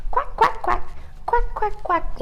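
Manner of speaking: high quacking like a duck; duck quacks. Shown as a demonstration. Teacher leading kids to quack like ducks, high duck quacks. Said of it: "Can we say quack".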